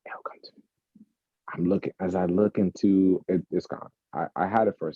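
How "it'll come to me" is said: whispered to self. Real, not acted. Host trying to recall a question, whispering to self. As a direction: murmur it under breath.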